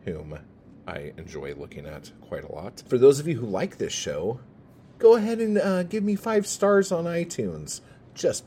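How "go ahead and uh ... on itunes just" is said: much higher, bright salesman sing-song; a hammed-up chipper request for five stars; chirpy high plea. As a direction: sell it with an overeager high voice. Cheerful plea for ratings.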